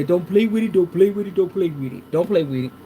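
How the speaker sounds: lightly imitated rapper catchphrase. Reader quotes her favorite rapper, light impression. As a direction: repeat it like a rap hook, lightly mimicked. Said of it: "When she say".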